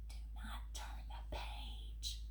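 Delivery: hushed whispered plea. Acted. Grover begs the reader not to turn, whispering. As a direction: breathe it like a secret.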